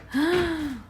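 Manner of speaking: acted-out gasp of alarm; sharp intake of breath, retelling a scare; mock terrified gasp; frightened gasp. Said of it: "it was kinda terrifying".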